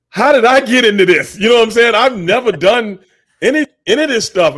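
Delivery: high, loud amazed outburst; can't believe how he got here; disbelief. Retold or imitated. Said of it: "And I'm like".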